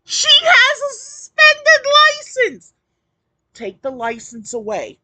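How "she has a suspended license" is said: high, loud disbelieving outcry; outraged cry. Real, not acted. Outraged reaction to clip.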